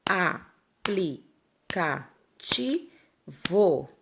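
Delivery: a-pli-ca-ti-vo said very slowly, one syllable at a time; "Aplicativo" clapped out syllable by syllable; split into syllables. Shown as a demonstration. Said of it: "Vamos separar comigo?". ("Aplicativo" separado em sílabas, bem devagar)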